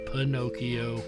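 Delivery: ordinary talk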